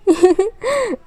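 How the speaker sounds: a high coy laugh; flirty giggle. Acted. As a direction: giggle at his charm.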